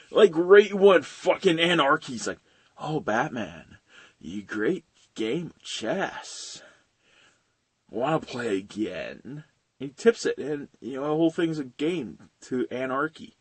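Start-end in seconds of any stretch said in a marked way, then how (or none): 2.8-9.4 slow, low, softer villain purr; lightly voiced sly villain teasing the hero; mock villain taunt. Voicing villain Anarchy taunting Batman.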